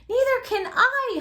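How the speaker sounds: high, loud, slow howl. A narrator's reading. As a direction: wail it out in fright. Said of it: "howled Flitter".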